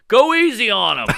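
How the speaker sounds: imitated shout from the crowd; calling from the back. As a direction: call it out across the club. Acts man calling from back.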